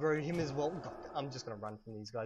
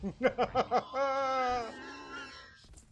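high laugh; 11 semitones higher, 5 dB louder than usual. Real, not acted. Laughs after killing mob.

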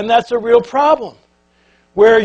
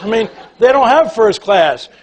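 normal talking voice

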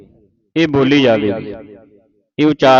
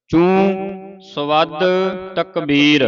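ਚੂੰ ਸਵਦ drawn out very slowly, a little higher; very slow recitation; 4 semitones higher, much slower than usual. Shown as a demonstration. Teacher modelling ਚੂੰ ਸਵਦ very slowly for pupils. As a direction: stretch ਚੂੰ ਸਵਦ out word by word. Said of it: "ਚੂੰ ਉਚਾਰਨ ਕਰਨਾ ਹੈ".

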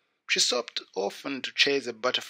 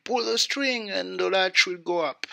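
lightly voiced granny, higher; a mild higher call from bed; grandmother calling out; 6 semitones higher, 3 dB louder than usual. A narrator's reading. Grandmother ill in bed calls out, mild higher call. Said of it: "called out".

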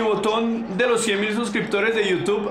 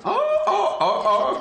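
high startled oh oh with a laugh; surprised yelp; 14 semitones higher than usual. Real, not acted. Streamer caught off guard by the fighter, high startled laugh. Opponent does something unexpected.

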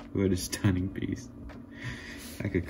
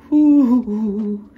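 a high, loud whoop of delight; excited whoop; 14 semitones higher, 11 dB louder than usual. Real, not acted.

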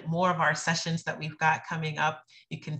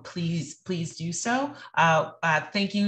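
ordinary delivery

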